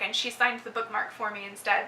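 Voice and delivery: normal talking voice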